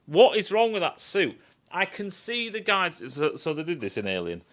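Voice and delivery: normal talking voice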